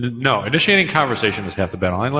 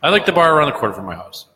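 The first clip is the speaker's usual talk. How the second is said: higher, quick, clear restatement; clearly spoken; 4 semitones higher, 4 dB louder, faster than usual. Real, not acted. Host repeating himself after being told he muttered, speaking up clearly. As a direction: say it up and out so nobody misses it.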